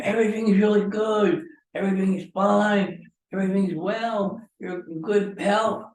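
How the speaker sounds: mock the cheerful doctor's reassurance, drawn out; imitating the doctor. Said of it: "He says to me".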